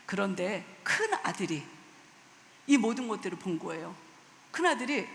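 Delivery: usual speaking voice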